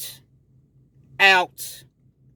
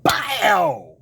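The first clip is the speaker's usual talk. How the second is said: sharp imitated punch, pop; a loud mouth sound for a hit; punch sound effect; 5 semitones higher, 4 dB louder than usual. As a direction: smack out a mock punch noise. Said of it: "next thing I know".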